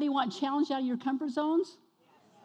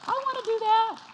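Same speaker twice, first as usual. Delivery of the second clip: high, louder burst of longing; eager outburst; 11 semitones higher, 5 dB louder than usual. Retold or imitated. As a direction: blurt it out fired up. Retold eager reaction to reading.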